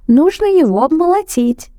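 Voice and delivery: high squeaky mouse; little mouse voice. A narrator's reading. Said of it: "мышат и говорят".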